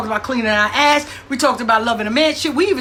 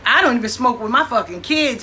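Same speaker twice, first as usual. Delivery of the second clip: ordinary delivery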